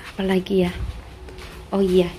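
ordinary delivery

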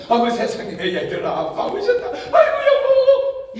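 high, drawn-out joyful welcome; overjoyed wife. Retold or imitated. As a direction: greet the husband like it's a miracle. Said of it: "이러면 행복할 텐데". (남편을 반기는 아내, 높고 길게 늘인 반가운 목소리)

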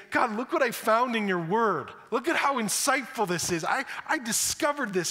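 mocking a believer proudly presenting scripture; eager show-off. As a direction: brag to God like an eager kid.

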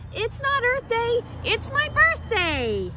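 high, proud monkey voice with NOT jumping up; proud silly monkey. Acted. Silly monkey brags to the jungle, high and proud. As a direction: crow it like a cheeky monkey. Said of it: "proudly said".

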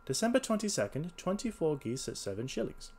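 high-pitched detective reading an entry; reading aloud. A narrator's reading. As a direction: read the ledger out in character. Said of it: "Now, read the last entry".

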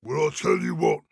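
big storybook troll; put-on troll's menacing offer. A narrator's reading. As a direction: say it as a troll striking a deal. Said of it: "said the Troll".

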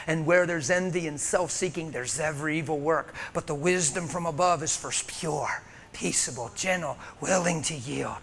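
hushed, lightly intoned scripture; soft scripture reading. Retold or imitated. Preacher softly reading James to the congregation. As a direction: read it softly like a meditation. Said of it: "I read things like James".

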